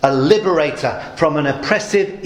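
ordinary talk

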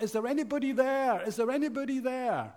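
retold cry for help from a cliff ledge; mock shout for help. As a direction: call up the cliff, pitched high. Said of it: "he shouts out".

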